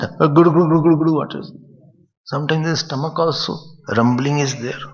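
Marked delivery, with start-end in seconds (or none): 0.0-1.5 louder, playful glu glu gurgle; playful gulping noise; gurgling water sounds. Shown as a demonstration. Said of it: "Sound doesn't?".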